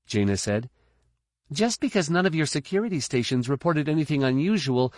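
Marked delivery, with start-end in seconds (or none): 1.5-4.9 put-on young woman's voice. A narrator's reading. Jaina continues.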